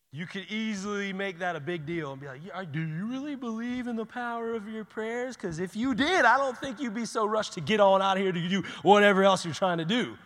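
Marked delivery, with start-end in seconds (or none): 2.4-10.2 mock scolding. Mock rebuke he avoided.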